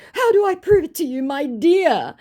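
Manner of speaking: high, loud, syrupy impostor question; mock scammer. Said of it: "Giving himself away again".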